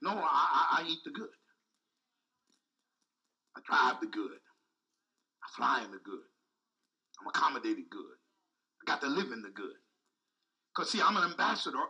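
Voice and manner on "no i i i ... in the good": low, soft, intimate confession of faith; a hint of hushed self-affirmation; low quiet declaration. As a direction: murmur the claim like a secret you believe. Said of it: "I'm saying".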